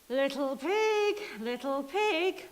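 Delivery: a hint of a sly wolf calling in. Wolf coaxing the pig to open up, lightly voiced. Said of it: "The wolf said".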